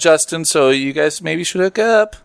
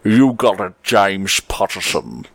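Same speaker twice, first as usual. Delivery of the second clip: Sean Connery accent; 5 semitones lower, slower than usual. Shown as a demonstration. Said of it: "in your best Sean Connery accent".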